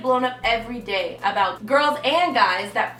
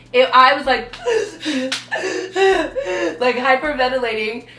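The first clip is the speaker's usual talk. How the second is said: laughing; 3 semitones higher, 5 dB louder than usual. Real, not acted. Laughing while retelling a breakdown.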